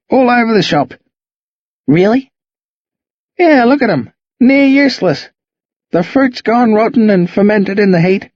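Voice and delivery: put-on laid-back reassuring old bloke; old Aussie bloke. Jack reassures about drunk bees.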